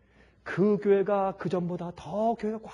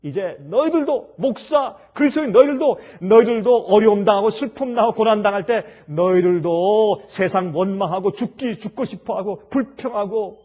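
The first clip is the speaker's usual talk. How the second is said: mocking gloat; 3 semitones higher, 11 dB louder than usual. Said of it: "마음속에 이렇게 생각했습니다".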